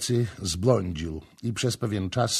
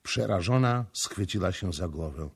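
plain reading voice

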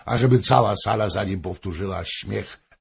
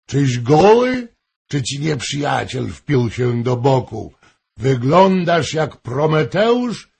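loud, high, theatrical questioning; hammed-up royal outburst; king shouting; 8 semitones higher, 9 dB louder than usual. A narrator's reading. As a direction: fire booming questions across the hall. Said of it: "krzyknął król".

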